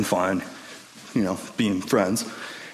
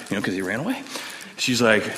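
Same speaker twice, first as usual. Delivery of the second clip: usual speaking voice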